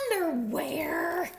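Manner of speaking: high, slow drawn-out holler; yell. A narrator's reading. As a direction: stretch it into a silly yell. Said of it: "yelled Duck".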